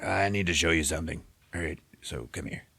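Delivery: slurred tipsy voice; drunk voice. Retold or imitated. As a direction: slur it like you're drunk. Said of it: "Are you drunk?".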